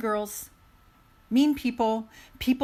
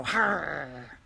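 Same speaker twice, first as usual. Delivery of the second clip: hurt animal growl; 4 semitones lower than usual. Retold or imitated. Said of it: "they're gonna go".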